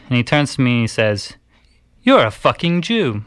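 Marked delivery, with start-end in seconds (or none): 2.0-3.2 high-pitched imitation of a British man's insult; attempted British accent. Man in a car insulting a cyclist, imitated British accent. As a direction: put on a rough attempt at British. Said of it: "it's my attempt at a British, British accent".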